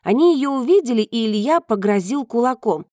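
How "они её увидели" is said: plain reading voice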